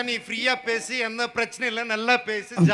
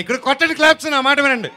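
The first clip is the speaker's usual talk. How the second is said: loud, higher call to the audience; rallying the crowd; 7 semitones higher, 10 dB louder than usual. Real, not acted. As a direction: whip up applause. Host rallying audience for claps.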